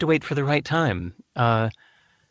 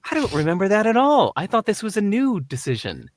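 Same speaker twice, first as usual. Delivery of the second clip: higher-pitched puzzled denial; baffled protest; 8 semitones higher than usual. Retold or imitated. Man learning of his forgotten dream, puzzled surprise. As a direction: protest in honest bewilderment.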